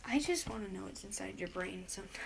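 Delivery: soft unvoiced whisper. Real, not acted. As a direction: whisper it under your breath. Teasing aside to co-host.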